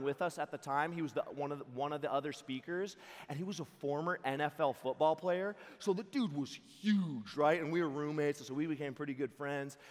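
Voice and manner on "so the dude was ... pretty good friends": ordinary talk, nothing marked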